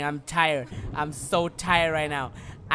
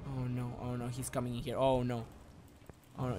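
scared hush; 4 semitones lower, 8 dB quieter than usual. Real, not acted. Enemy approaching, hiding.

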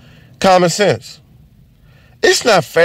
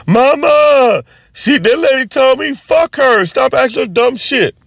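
put-on bratty kid; 11 semitones higher than usual. Kid tattling to mom about the waitress, high bratty. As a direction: whine it like a spoiled kid tattling.

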